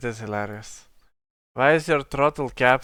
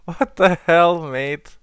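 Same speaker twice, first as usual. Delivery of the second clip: amused disbelief; 5 semitones higher, 7 dB louder, slower than usual. Real, not acted. Laughing disbelief at data found.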